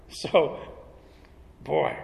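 ordinary talk, nothing marked

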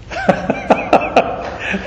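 high breathy burst of laughter; delighted laugh. Real, not acted. Preacher laughing in delight at the steward's trick. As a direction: laugh at how clever the trick is.

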